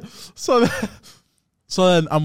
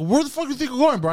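higher-pitched, aggressive challenge; retold aggression; 10 semitones higher, faster than usual. Customer stopping the fleeing driver, mock-aggressive. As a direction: confront him, pitch jumping up. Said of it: "I get all aggressive with him".

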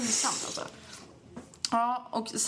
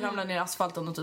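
ordinary talk

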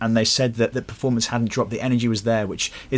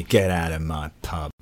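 low, slow London accent; 6 semitones lower, 3 dB quieter, slower than usual. Shown as a demonstration. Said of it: "the classic for London would be".

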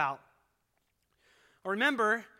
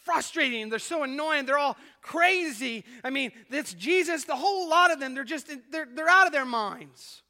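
higher, louder, fed-up muttering of an annoyed governor; exasperated Pilate; 6 semitones higher, 6 dB louder than usual. Retold or imitated. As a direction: vent like a ruler sick of these people. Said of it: "Pilate pacing around his palace, thinking and saying to himself".

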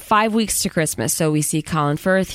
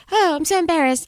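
high, mock-flustered little voice; mock embarrassed girl; 11 semitones higher than usual. Podcaster imitating the flustered assistant, in a mock high embarrassed voice. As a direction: ham up a flustered, embarrassed young woman. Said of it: "And she's like".

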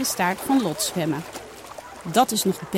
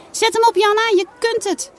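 high, loud cheering shout; 12 semitones higher, 4 dB louder than usual. Acted. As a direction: cheer her on from the water. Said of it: "schreeuwen Saar en Lot". (luid aanmoedigend roepen)

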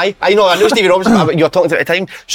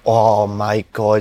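mock dismay; 6 semitones lower, 3 dB quieter, much slower than usual. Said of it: "that you went".

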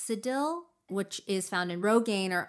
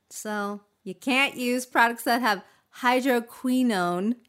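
ordinary talk

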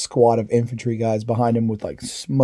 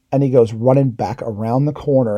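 ordinary talk, nothing marked

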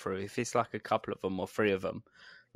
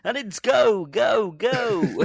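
loud, high race-start commentary; hammed-up motor racing announcer; Formula One announcer; 10 semitones higher, 7 dB louder, much slower than usual. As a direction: call the start of a race at full voice. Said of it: "guy who introduces the Formula One".

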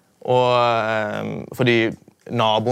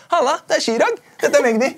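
much higher, louder imitating the rappers' hello; cool rappers' greeting; 5 dB louder than usual. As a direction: mimic the rappers introducing themselves. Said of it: "Og bare". (etterlignet rapperhilsen, mye lysere og høyere)

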